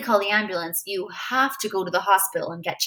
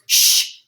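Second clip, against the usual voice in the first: shushing; 5 dB louder than usual. Acted. Freak hushes about secret plan.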